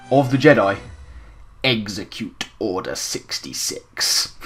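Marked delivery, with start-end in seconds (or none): 1.6-4.3 slow, soft, low villain impression; Emperor Palpatine. Host quotes the Emperor's famous line, slow Palpatine impression. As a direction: give the order slowly, quietly, in a villain's voice. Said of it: "his very famous line".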